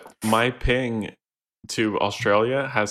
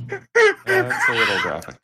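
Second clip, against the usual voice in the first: much higher, louder squeaky repeat; mock prude; 5 dB louder than usual. Reacting to a crude joke, squeaky mock-prude repeat.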